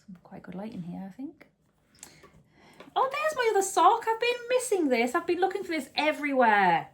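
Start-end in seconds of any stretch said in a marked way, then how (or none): 2.9-6.9 much higher and louder, thrilled to find it; happily startled at the missing sock; delighted surprise. Real, not acted. Finds the lost sock.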